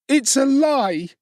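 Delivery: high, slow outraged denial; a child refusing to back down; defiant boy. A narrator's reading. Oliver to Mrs Sowerberry, high defiant protest.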